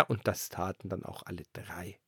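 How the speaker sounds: usual speaking voice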